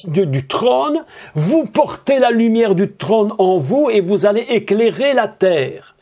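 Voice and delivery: ordinary talk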